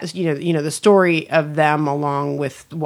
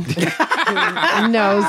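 laughing; 3 semitones higher than usual. Real, not acted.

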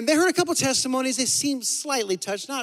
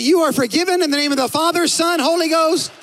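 grand blessing; 6 semitones higher, 6 dB louder than usual. Pretend. Pastor pronounces forgiveness, joking grandly.

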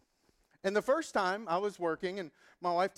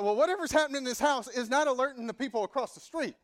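higher, louder mock outrage; indignant retort; 7 semitones higher, 6 dB louder than usual. Tenant arguing back at the landlord, higher indignant retort. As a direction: protest the accusation.

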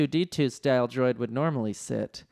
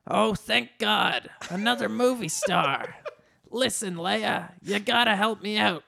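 high character voice, relieved and pleading; Danny DeVito; 7 semitones higher than usual. A narrator's reading. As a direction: play a little man begging a star for help.

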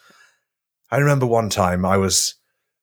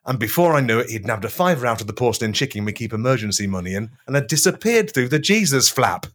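ordinary delivery

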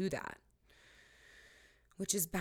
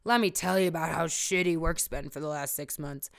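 Uncle Jerry; 8 dB louder than usual. Retold or imitated. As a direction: boom out a greeting like a chatty uncle. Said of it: "Uncle Jerry's like".

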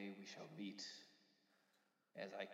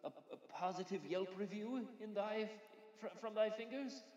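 higher, louder archaic ferryman; mock biblical prophet; 11 semitones higher, 12 dB louder than usual. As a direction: ham up the old-fashioned speech. Ferryman asks for Yelp review.

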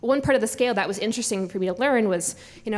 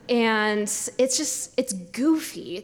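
normal talking voice

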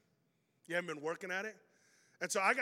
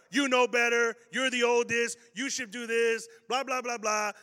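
loud, high nagging parent; hammed-up mom and dad scolding; mock parental scolding; 5 semitones higher, 8 dB louder than usual. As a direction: rattle off the lecture fast and loud. Parents scolding the oldest child.

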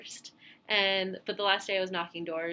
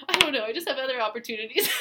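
flustered excuse; 5 semitones higher, 6 dB louder, faster than usual. Retold or imitated. Retold awkward excuse to boss.

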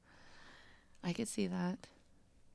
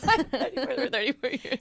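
put-on higher, louder shaky old guy; quivering old man voice; 5 semitones higher, 5 dB louder than usual. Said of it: "You hear the quivering in his voice".

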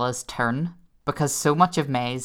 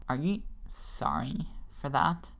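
quiet villain; 9 dB quieter than usual. Retold or imitated. Quoting the villain's line.

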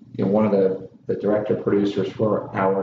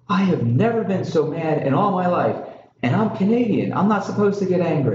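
higher-pitched, hammed-up outrage; imitating a furious producer losing it, pitched up; mock angry scream; 7 semitones higher than usual. Producer yelling at the crew in the heat of battle, mock furious. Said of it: "this is what he screams".

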